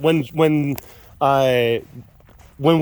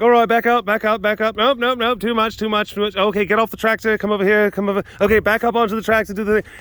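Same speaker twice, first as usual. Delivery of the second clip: calling out directions; 6 semitones higher than usual. Retold or imitated. Barking tractor directions to Jeremy.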